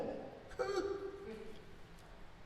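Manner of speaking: short airless laugh at the notion; quiet scoffing laugh. Acted. Laughing at the man's claim that Christ wasn't a woman, soft and scornful. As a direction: let a soft scornful chuckle escape.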